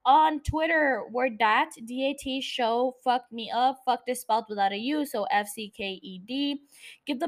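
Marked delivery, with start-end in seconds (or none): none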